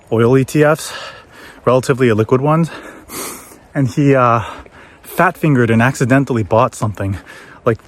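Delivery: normal talking voice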